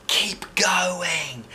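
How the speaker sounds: whispered encouragement; stage whisper. A narrator's reading. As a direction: whisper it from the wings. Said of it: "she said".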